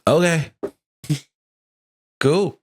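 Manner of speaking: unbothered, brief reply, acted; indifferent. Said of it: "you get that indifferent".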